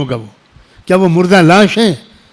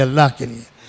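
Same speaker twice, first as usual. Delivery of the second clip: "اللہ کے لیے" dropped much softer and lower; hushed plea; 5 semitones lower, 7 dB quieter than usual. Shown as a demonstration. Preacher begs the youth, suddenly hushed and intimate. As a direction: sink to an intimate near-hush on "اللہ کے لیے". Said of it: "خدا کے لیے".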